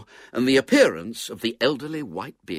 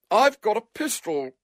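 high-voiced boy's stern warning; 12 semitones higher, 4 dB louder than usual. A narrator's reading. Boy threatening man through locked door, stern. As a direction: warn him sternly, pitched high. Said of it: "said William sternly".

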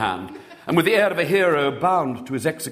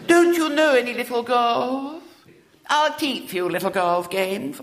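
put-on lisping little girl; 11 semitones higher than usual. A narrator's reading. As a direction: lisp it like a spoilt small girl. Little girl voiced in story.

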